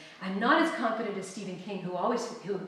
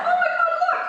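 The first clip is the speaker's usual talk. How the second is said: very high, loud exclamation; mock horror; 11 dB louder, faster than usual. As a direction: shriek it in fun. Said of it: "retold shock".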